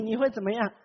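usual speaking voice

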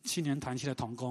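usual speaking voice

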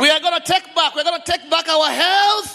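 building from firm to shouted preaching; rally speech. Acted.

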